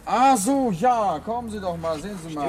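calling over, upset. Acted. Calling Harper over to the car.

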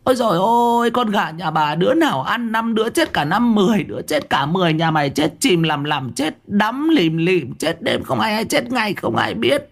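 a wife shrieking curses at the unseen thief; hammed-up village cursing rant. A narrator's reading. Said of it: "tiếng của vợ ông cứ thế mà choa choa".

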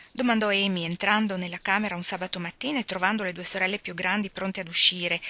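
usual speaking voice

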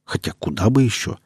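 ordinary delivery, nothing marked

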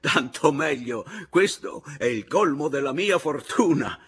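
loud, high, wild exultation; yelling. A narrator's reading. Husband celebrates his wife's remarriage, yelling. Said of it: "gli gridai".

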